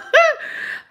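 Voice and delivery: squealing high laugh; real high-pitched laugh. Real, not acted. Laughs at dirty jokes.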